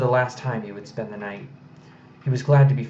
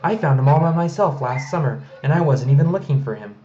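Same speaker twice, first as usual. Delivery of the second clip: put-on higher, louder little girl; eager little girl; 4 semitones higher, 7 dB louder than usual. A narrator's reading. Medley boasting to her father and Galen, eager. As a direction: insist like a small child.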